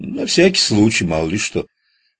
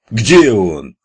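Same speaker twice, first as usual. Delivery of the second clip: much louder yell; 3 semitones higher, 8 dB louder than usual. A narrator's reading. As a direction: bark the question out hard. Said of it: "заорал Воробей".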